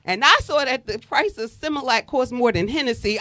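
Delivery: usual speaking voice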